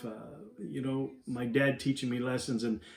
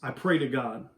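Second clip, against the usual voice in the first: gentle closing exhortation; 5 semitones lower than usual. Acted. Closing devotional exhortation over music.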